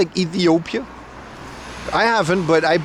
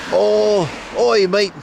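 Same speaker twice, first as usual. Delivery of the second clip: mock Australian greeting; imitating an Australian; 3 semitones higher, much slower than usual. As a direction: put on an Aussie accent, hailing a mate. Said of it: "Every Australian I've ever met".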